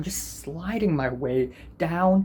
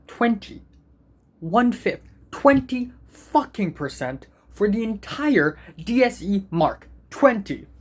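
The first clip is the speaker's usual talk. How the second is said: higher and louder, stressing twenty; exasperated rant; 6 semitones higher, 3 dB louder than usual. Real, not acted. To viewers about the SBA weighting, an exasperated outburst. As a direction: vent at the unfair twenty percent.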